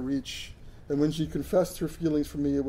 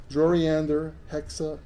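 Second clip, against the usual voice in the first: plain reading voice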